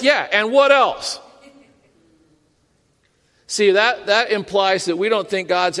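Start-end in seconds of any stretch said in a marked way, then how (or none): 0.0-1.2 slow, higher-pitched, unimpressed retort; acted impatient dismissal. Believer answering the pastor who says pray, acted impatience. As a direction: brush off the advice, hammed-up.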